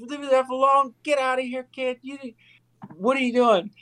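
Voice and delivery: high-pitched mock outburst; retold flustered tirade, voice pushed up high; freaking out. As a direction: imitate a fed-up wrestler losing it at a kid. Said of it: "he just freaks out".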